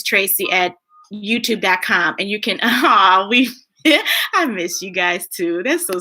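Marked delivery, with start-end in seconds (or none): none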